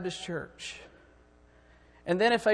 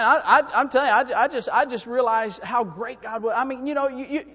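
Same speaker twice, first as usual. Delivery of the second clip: ordinary delivery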